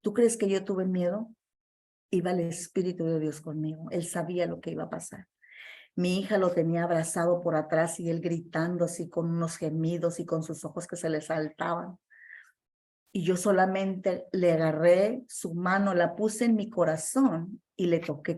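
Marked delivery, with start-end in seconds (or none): none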